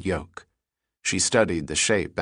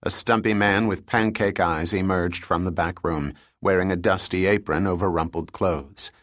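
plain reading voice

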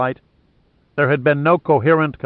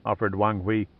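plain reading voice

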